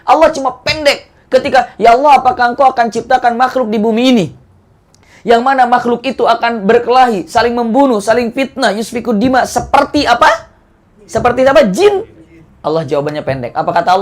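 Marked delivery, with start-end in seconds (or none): 1.8-12.1 higher, insistent questioning voice; angel asking. Acted. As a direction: let the angel plead and press the question. Angel questions God in story. (malaikat bertanya dengan nada tinggi mendesak)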